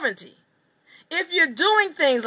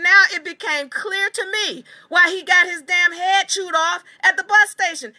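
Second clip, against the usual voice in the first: normal talking voice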